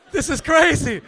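high, loud retold astonishment; amazed outburst. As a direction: cry it out in giddy disbelief. Retells own amazement at the moment.

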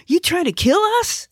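very high-pitched alarm; panicked protest. Acted. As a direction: squeal it in panic at the driver. Panicked passenger confronts careless driver.

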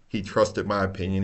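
ordinary talk, nothing marked

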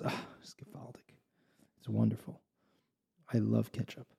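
a giddy little whisper at the table; hushed excited whisper. Acted. Innkeeper whispers to the rebbe about the ketchup, excited and hushed.

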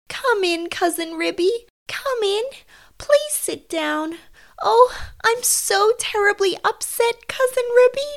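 high, tearful mother cat voice. Acted. Tabitha greeting her cousin after losing her son, tearful and high. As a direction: welcome a guest in a high, upset wail. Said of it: "shedding tears".

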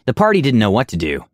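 plain reading voice

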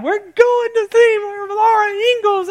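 high, slow, squealing gush; excited wife imitation. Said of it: "And she's all excited".

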